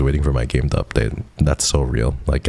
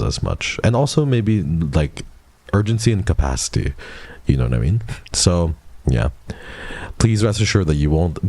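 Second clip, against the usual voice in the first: ordinary delivery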